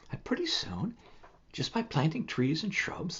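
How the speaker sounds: hushed storytelling whisper. Acted. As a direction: whisper it like a secret.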